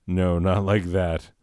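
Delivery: ordinary delivery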